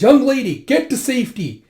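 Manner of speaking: high, loud call of warning; urgent shout. Acted. Wounded Zeno sends young lady away, urgent shout. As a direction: shout to send her to safety.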